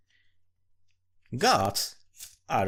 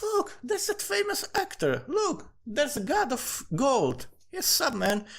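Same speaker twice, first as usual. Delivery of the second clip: excited street gawker. Retold or imitated. Passerby pointing out a god in the street, excited high gawking. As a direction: gush like a fan spotting a celebrity.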